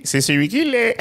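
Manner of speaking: high playground comeback. Real, not acted. Childish comeback, laughing.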